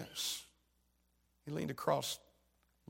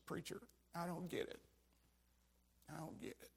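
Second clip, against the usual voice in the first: softer, almost whispered bewilderment; hushed disbelief; 3 semitones higher, 8 dB quieter than usual. Retold or imitated. Preacher asking the grieving father, hushed and bewildered. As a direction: say it quietly, lost for words.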